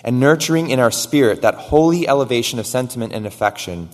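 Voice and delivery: loud, building sermon reading; reading an old sermon aloud to the congregation, loud and fervent; preaching at full force. Acted. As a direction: proclaim it with rising fervour. Reads Miller's sermon quote.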